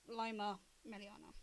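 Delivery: ordinary delivery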